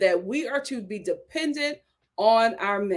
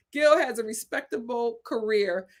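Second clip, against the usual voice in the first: more measured, deliberate reading; slowed-down retake. Real, not acted. Host rereading a comment after rushing it, slowed down. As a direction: take the words one at a time. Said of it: "I'm talking too fast".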